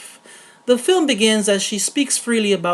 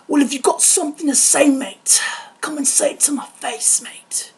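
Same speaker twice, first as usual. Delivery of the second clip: put-on Jason Statham-style British tough-guy accent; 5 semitones higher than usual. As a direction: rasp a challenge in higher, half-whispered British accent. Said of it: "here's one in a British accent".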